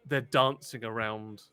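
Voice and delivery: usual speaking voice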